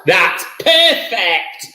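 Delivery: high, slow, drawn-out praise; delighted approval. Real, not acted. Host reacts to improved sound.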